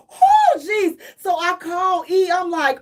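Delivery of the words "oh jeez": higher, louder alarmed cry; panicked shout. Retold or imitated. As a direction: blurt it out in panic. Can't sit still, panicking.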